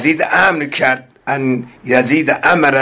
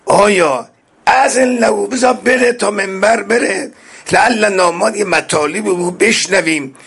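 crowd pleading; 7 semitones higher than usual. Acted.

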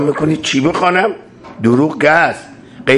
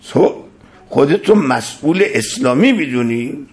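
ordinary talk, nothing marked

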